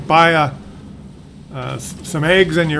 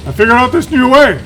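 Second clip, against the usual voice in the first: high, loud hammed-up pride; imitating dad bragging about his cooking, high and loud; mock dad boast; 9 semitones higher, 6 dB louder than usual. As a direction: ham up the proud cook. Mocking dad's boast.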